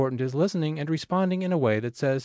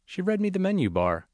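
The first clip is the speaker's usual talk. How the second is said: usual speaking voice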